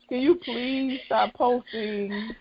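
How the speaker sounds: ordinary talk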